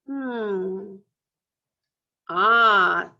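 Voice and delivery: usual speaking voice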